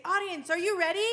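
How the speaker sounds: loud call to the crowd; rallying call. Retold or imitated. Host calling to the improv audience, loud rallying call. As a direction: pump up the audience. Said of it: "Then they say".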